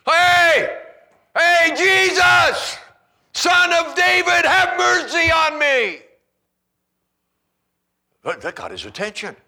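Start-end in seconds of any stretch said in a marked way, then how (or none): 0.1-6.0 high, very loud desperate shout; full shout. Acted. Preacher shouting as the blind beggar to Jesus, loud and desperate. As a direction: cry out with everything you've got. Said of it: "can I shout for you a little bit so you feel it".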